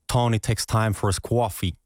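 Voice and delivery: demonstrated Brooklyn American English; Brooklyn accent. Shown as a demonstration. Guest showing a practice sentence for accents, Brooklyn accent. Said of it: "in Brooklyn hebben ze ook een bepaald accent".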